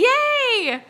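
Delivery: bright burst of joy at a friend joining; excited yay. Real, not acted. Host cheering a viewer's arrival, a high delighted squeal.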